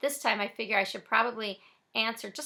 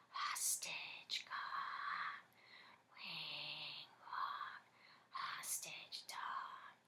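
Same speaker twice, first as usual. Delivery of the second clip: slow eerie whisper; 6 semitones lower, 16 dB quieter, much slower than usual. Acted. Inner voice whispering.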